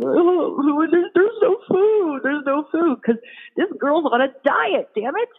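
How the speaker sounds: high, slow, feeble critter whimper; tiny dying creatures moaning; starving critters. Retold or imitated. Starving critters lamenting the diet, high and feeble.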